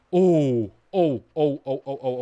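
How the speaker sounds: excited. Real, not acted. Delighted at getting orb.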